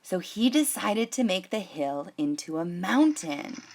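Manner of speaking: put-on gentle read-aloud voice for children; unhurried picture-book narration; storybook reading. A narrator's reading.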